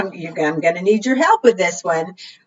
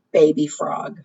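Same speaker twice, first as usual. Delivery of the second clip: ordinary delivery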